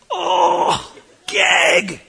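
high, squeaky cry of disgust; mock gagging. Passenger reacting to the stench, high mock gag. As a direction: squeal it like you might retch. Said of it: "I'm like".

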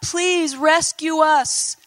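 slow, high-pitched mock plea; crying out. Israelite slaves begging God for rescue, crying out high and slow. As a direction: cry out for rescue, drawn out. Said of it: "they cried out to God".

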